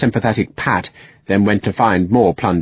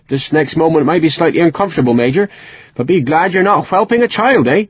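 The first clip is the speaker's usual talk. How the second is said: put-on West Country accent; 6 semitones higher than usual. A narrator's reading. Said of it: "strong West Country accent".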